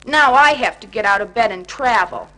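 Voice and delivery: loud grumbling complaint; complaining. Acted. Grumpy Bear griping about leaving bed, loud complaint. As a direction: gripe it out, fed up. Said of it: "he complained".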